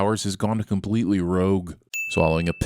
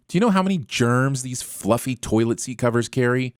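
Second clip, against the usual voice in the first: ordinary talk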